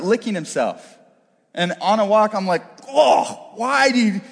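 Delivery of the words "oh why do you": higher, exasperated groan; disgusted reaction. Retold or imitated. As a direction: recoil at what the dog is doing. Disgusted owner reacting to dog.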